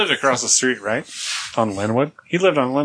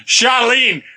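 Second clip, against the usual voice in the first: hollering husband; 4 semitones higher, slower than usual. Acted. Husband calling out to his wife from the couch, mock yell. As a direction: bellow for Charlene like a lazy husband.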